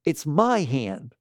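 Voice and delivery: drawn-out it's my hand; slow mock claim. Quoting the listener's claim, slow and drawn out. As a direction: stretch each word, mock possessive. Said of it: "You said".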